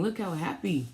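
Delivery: usual speaking voice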